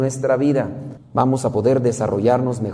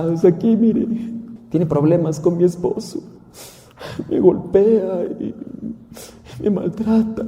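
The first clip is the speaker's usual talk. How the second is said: battered wife's lament; 6 semitones higher than usual. Acted. Wife tells the missionary her husband beats her, high and pitiful. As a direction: plead your sorrows like a beaten wife.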